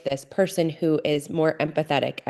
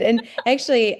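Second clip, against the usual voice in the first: ordinary talk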